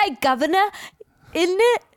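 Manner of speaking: high, put-on British accent. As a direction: ham up a fake British accent. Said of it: "She's like".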